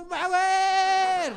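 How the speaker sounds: high, loud yell of "Power!"; power shout. Shown as a demonstration. Speaker shows newcomers how to shout on stage, loud high yell. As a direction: belt it out to shake off the nerves. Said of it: "Pag sumisigaw ka".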